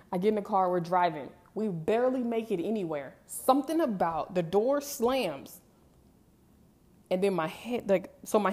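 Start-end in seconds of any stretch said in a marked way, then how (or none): none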